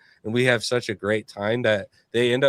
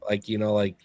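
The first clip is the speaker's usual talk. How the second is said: normal talking voice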